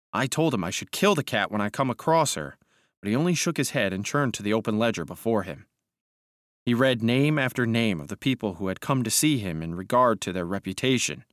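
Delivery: plain reading voice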